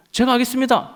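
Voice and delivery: mock keen private; eager recruit. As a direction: shout out your volunteer.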